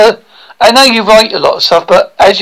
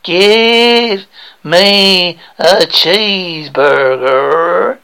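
one word at a time; much slower than usual. Acted. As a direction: drone each word out separately. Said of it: "One word at a time".

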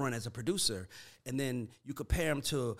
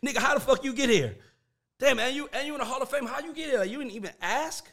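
incredulous; 10 semitones higher, 5 dB louder than usual. Real, not acted. Marvelling at skipping the steps.

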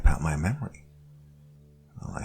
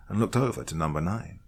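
ordinary delivery

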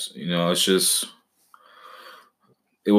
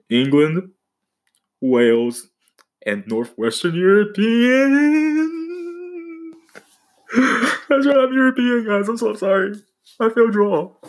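mock despair. Dramatic confession of European ancestry.

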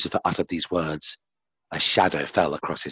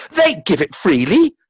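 much higher and louder, defiant outburst; defiant cry; 11 semitones higher, 6 dB louder than usual. A narrator's reading. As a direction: fire it back in defiance. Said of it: "cried Lucifer defiantly".

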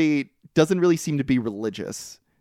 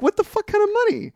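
incredulous; faster than usual. Real, not acted. Outraged reaction to the movie.

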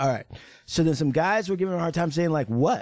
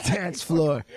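ordinary talk

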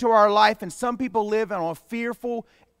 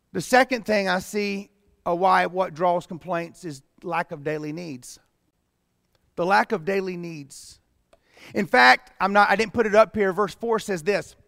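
ordinary delivery

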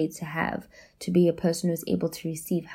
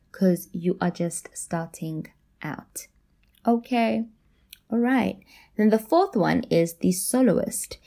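usual speaking voice

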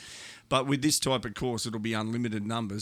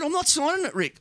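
stubborn refusal; 4 dB louder than usual. Retold or imitated. Father refuses again.

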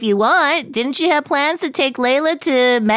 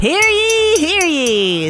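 town crier; 6 semitones higher, much slower than usual. Acted. Mock formal speech opening.

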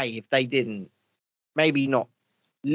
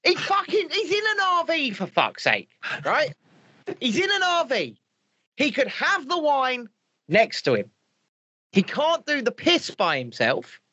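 high-pitched exasperated protest; incredulous, high-voiced complaint; exasperated rant; 8 semitones higher than usual. Real, not acted. Mock rant about the RV.

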